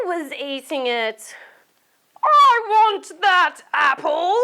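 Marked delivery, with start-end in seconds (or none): 2.3-4.3 high, loud furious shout; angry shout. Acted. Marvin shouts at Molly for his apple, furious shout. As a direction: yell it like a cross little monster. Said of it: "shouted Marvin".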